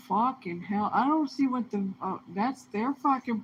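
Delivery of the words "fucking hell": ordinary delivery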